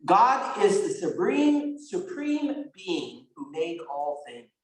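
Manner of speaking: slow recited catechism answer; slow pupil's recital; rote answer. Shown as a demonstration. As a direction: recite it slowly like a drilled pupil. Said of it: "the answer is supposed to be".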